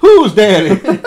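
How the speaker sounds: loud, high, drawn-out and giggling; high slow laughing shout. Acted. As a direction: squeal it out laughing. Acted joke dialogue.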